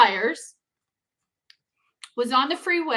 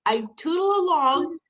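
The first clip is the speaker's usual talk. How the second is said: ordinary talk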